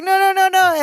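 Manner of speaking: high string of protests; lightly panicked, high-pitched retelling; mock alarm. Retelling Balky trying to stop Larry, mock alarm.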